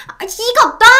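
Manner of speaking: very high-pitched shriek; woman's yell. A narrator's reading. Mistress dragged by the hair by the wife, shrieking protest. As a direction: screech in protest at being grabbed. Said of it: "여자가 소리를 지르든 말든".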